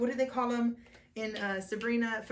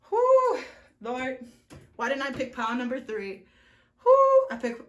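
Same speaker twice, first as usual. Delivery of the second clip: loud whoo; 8 dB louder than usual. Real, not acted. Reader envies pile three's reading, whooping loudly. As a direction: let out a big envious whoo.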